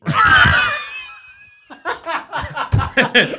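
shouting through laughter; laughing shout. Real, not acted. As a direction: laugh it out loud. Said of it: "am I slurring my words?".

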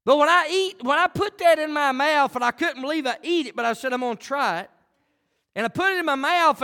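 lively pulpit story; animated preaching. Acted. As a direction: tell it big to the church.

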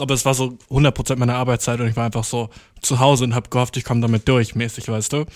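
ordinary delivery

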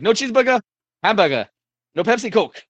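put-on loud, high Greek accent. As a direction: shout it like a Greek diner owner. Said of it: "in his, uh, Greek accent".

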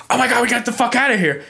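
high-pitched mock panic; panicked. Girl urging her friend to flee after a scream, panicked. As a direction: imitate a frightened girl, voice jumping up.

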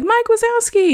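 put-on very high child's call of the name; host voices the little kid calling Mike, very high. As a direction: pipe it like a toddler. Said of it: "one of the little kids is like".

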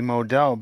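Modell said "moh-DELL"; Germanized pronunciation. Shown as a demonstration. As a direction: say Modell with a long O, landing on "dell". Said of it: "if you were probably more Germanized".

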